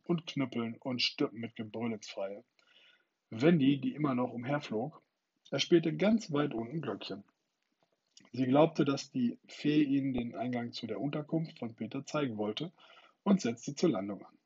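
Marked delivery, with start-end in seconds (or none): none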